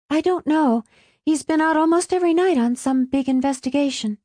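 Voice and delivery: put-on high-pitched, hurried girl's voice; quickly added. A narrator's reading. Alice hastily explains to her mother, quickly. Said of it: "Alice added quickly".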